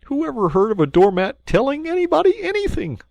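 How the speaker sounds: sulky and dismissive; petulant. Acted. Mole pettishly dismissing Rat's folly. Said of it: "said the Mole quite pettishly".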